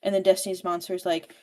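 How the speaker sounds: ordinary talk, nothing marked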